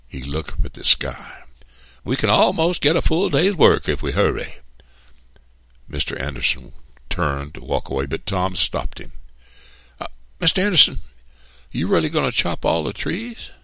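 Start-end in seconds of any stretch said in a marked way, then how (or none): 2.0-4.6 high, loud urging; eager boss. A narrator's reading. Boss urges Tom to hurry the work, higher and louder. 10.0-13.5 high-pitched hesitant question; timid workman. A narrator's reading. Tom stops his boss to ask about trees, high and timid.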